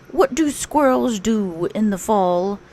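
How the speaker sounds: a dreamy, simple little bear; Pooh. Acted. Pooh telling Rabbit about his pumpkin, dreamy bear voice.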